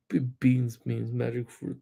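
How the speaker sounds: put-on a shy kid who can barely get the rhyme out; timid mumble. Nervous student reciting to the class, mumbling and fading out. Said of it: "Louder. If you're gonna say it".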